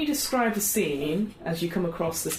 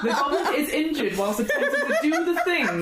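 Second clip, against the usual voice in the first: laughing; 8 semitones higher than usual. Real, not acted. Rule read laughing.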